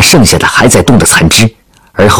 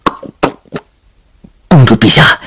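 mock-frightened stammer; 3 semitones higher than usual. Soldier begging the princess off the launcher, jokingly scared stammer. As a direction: stumble over the first syllable in feigned fear. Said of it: "脸上现出很害怕的样子".

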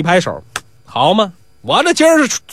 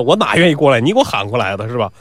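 ordinary delivery, nothing marked

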